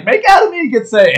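very high, loud mock yell; hammed-up squawk of insistence; Mike Tyson. Podcaster imitating the boxer still pressing, high-pitched yell.

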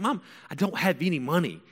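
high, pleading protest; acted-out teen whine to Mom; exasperated. Son explains to mom why he hasn't proposed, exasperated.

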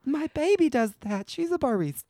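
high, doting mom impression; man doing a woman's voice. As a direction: gush like a proud mother. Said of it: "That's my impression of your mom".

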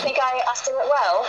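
eager question in put-on English; English accent. Shown as a demonstration. Guest asking whether the impression works, English accent. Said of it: "I love to talk with an English accent".

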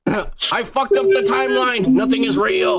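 mock sci-fi hero realizing the timeline broke; mock panic. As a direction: play the frantic time traveler. Mock time-travel panic joke.

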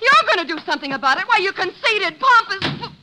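wife firing back at husband; furious retort. Acted. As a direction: hurl it back at him. Wife fires back in quarrel.

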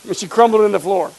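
usual speaking voice

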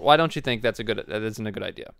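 normal talking voice

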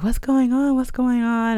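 high-pitched, urgent questioning; worried cousin. Retold or imitated. Cousin hearing her crying on the phone, worried.

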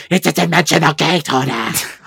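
a hint of a villainess; Shadow Weaver voice. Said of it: "her Shadow Weaver voice".

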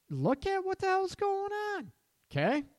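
very high-pitched, exasperated cry; high squeal of exasperation; exasperated urging. Real, not acted. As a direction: shoot the voice way up, insistent. Exasperated urging to face problem.

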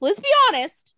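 now announce it to everyone; loud. Real, not acted. Host repeats the secret to the listeners, loudly.

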